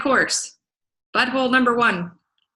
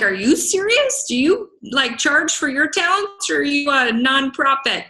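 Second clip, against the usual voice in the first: mock incredulous comeback; 4 semitones higher than usual. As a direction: echo their "Are you serious?" back at them, mocking. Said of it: "I'm like".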